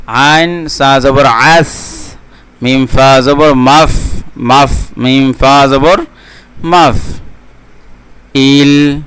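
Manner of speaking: slow, measured syllable-by-syllable recitation; Quran spelling drill. Shown as a demonstration. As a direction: draw out each syllable for learners to repeat. Teacher models spelling drill.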